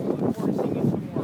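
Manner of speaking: usual speaking voice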